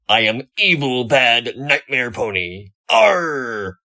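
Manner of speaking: loud, hammed-up scary pony act; mock monster growl. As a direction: play the spooky villain for laughs. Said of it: "go up to any stable pony and go".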